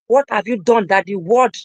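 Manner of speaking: usual speaking voice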